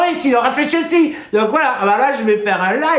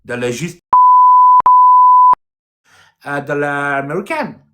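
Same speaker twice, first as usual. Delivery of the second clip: mock English speaker; 9 semitones lower, 7 dB louder than usual. Showing a viewer his English, in a low crooning put-on voice. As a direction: croon the broken English like a lounge lover.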